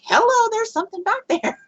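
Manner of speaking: high-pitched, insistent hello; mock calling out. Passenger alerting friends in the car, high mock call-out. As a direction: pipe up high to get their attention.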